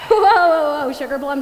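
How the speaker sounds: loud, high startled whoas, settling down; startled calming. Acted. Listener calming the worked-up angel, startled and loud. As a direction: burst out high and loud, then rein it in.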